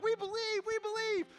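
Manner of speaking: cheering crowd. Retold or imitated. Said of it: "they're like".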